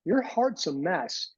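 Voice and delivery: slow, deliberate verdict; blunt nurse. Retold or imitated. Nurse practitioner tells the patient his heart is bad, slow. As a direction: spell out the bad news slowly. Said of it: "And she goes".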